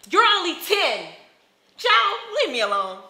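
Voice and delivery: angry outburst. Acted. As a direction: yell at a pestering child to back off. Snaps at the pestering child.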